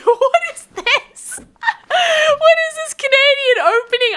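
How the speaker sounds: high-pitched, real laughing bafflement; laughing disbelief. Real, not acted. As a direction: crack up while asking, amused and baffled. Amused disbelief at chat's greeting.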